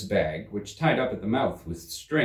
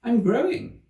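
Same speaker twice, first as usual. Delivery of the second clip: put-on high girl's voice, a bit louder; a young girl startled by her own growing; Alice alarmed; 5 dB louder than usual.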